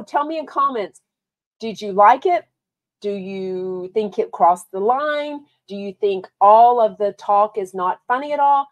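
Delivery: ordinary talk, nothing marked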